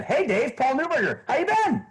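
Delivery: put-on high, bright friendly hello like an old pal; upbeat familiar greeting. Shown as a demonstration. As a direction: greet him like you already know him. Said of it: "what are you thinking in your head?".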